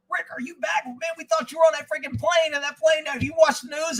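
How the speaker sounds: agitated, high-pitched rush of questions; urgent callers. Retold or imitated. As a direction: voice a worried friend urgently checking in. Retelling frantic callers that morning.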